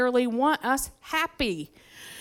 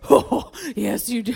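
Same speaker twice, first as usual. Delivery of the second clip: put-on retorting teen. Daughter snaps back at her father, mock defiant. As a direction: fire back at dad, half laughing. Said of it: "And I was like".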